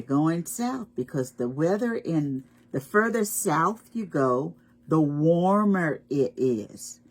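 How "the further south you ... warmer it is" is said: ordinary delivery, nothing marked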